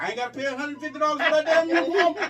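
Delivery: high, loud and fast, fed up; mama's voice. Retold or imitated. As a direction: rattle it off like an annoyed mom. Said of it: "'cause she was like".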